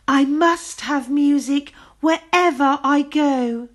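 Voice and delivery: high, slow haughty lady. A narrator's reading. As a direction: look down your nose. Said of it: "said the fine lady haughtily".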